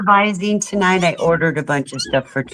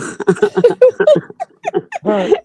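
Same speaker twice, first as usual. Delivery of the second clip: a high, bright cackling laugh at her own joke; high burst of laughter. Real, not acted. Owner laughing at her kitten name joke, high laugh.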